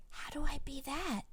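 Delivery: whispered question in a small voice. Acted. Little brother asking how to be a rock, whispered. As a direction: ask it in a hushed whisper. Brother unsure of his role.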